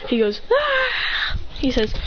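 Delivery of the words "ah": high, startled ah; a short high gasp of a word; surprised ah. Retold or imitated. A friend startled by the sudden arrivals, high.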